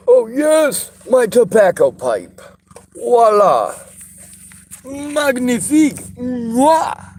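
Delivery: high, loud hammy French chef. Pretend. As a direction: flourish it like a proud chef. Hammy French flourish over the pipe.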